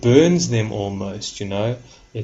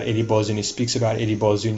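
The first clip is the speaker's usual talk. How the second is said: ordinary talk, nothing marked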